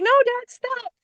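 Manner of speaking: put-on high, urgent child's cry; lightly voiced frightened kid; mock alarmed kid. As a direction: yelp it like a scared boy. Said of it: "He's like".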